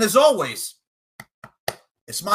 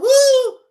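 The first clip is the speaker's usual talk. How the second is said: very high, loud hype cry; excited woo; 11 dB louder than usual. Acted. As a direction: let out a wrestling woo. Hype cry after matchup call.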